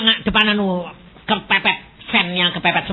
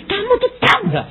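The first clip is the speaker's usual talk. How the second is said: angry scolding; faster than usual. Retold or imitated. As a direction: scold the driver from above. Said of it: "saya bilang".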